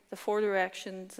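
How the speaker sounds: normal talking voice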